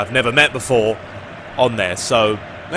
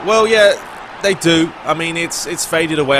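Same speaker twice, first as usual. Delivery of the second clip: normal talking voice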